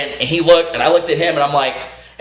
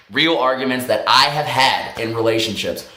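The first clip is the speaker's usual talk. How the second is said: usual speaking voice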